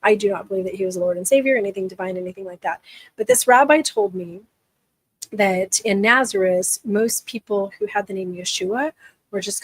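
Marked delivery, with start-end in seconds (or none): none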